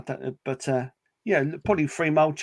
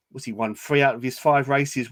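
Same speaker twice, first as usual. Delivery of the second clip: ordinary talk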